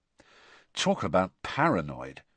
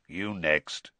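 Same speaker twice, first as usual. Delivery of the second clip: growled; 5 semitones lower, 4 dB quieter than usual. Acted. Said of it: "Moody growled".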